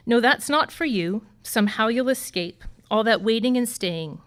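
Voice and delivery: ordinary delivery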